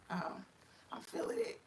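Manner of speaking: hushed whisper. Retold or imitated. Whispering to my friend that it kicked in. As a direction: breathe it out secretly. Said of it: "And I'm like".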